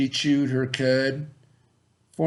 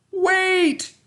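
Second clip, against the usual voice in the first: much higher, louder call; yelling after him; 5 dB louder than usual. Acted. Miss Lurleen calling after Bubba, high and loud. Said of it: "she yelled".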